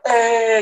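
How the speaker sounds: high, loud "eh" yell; sudden demonstration yell, held out; imitated scream. Man showing listeners how he screamed, imitated scream. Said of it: "Saya teriak".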